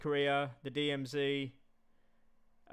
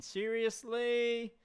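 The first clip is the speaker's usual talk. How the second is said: drawn-out disbelief; 7 semitones higher, much slower than usual. Real, not acted. Disbelief at viewer's answer.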